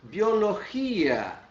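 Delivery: biologia said slowly the Spanish way, stress on gí; slow Spanish biología; teacher pronouncing Spanish biologia; Spanish pronunciation. Shown as a demonstration. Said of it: "se pronuncia".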